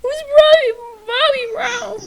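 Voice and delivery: high, squeaky laughing; laughing hard. Real, not acted. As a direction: laugh through the words. Laughing fit.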